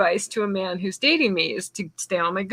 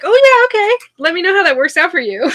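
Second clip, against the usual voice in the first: much higher, louder mock-cheery "Oh, yeah"; sarcastic brush-off; 10 semitones higher, 6 dB louder, faster than usual. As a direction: fake enthusiasm that means you're doomed. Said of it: "I'm like".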